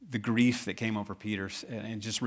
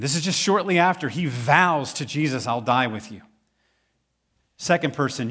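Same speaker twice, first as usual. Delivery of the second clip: raised, higher sermon voice building; louder, higher pulpit voice; fervent preaching; 5 semitones higher, 6 dB louder than usual. Acted. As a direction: hammer home the denials. Preaching Peter's denials with fervour.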